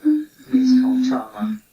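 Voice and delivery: hammed-up dramatic declaration. Naming the fight's effect, a hammed-up dramatic line. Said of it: "This is dramatic".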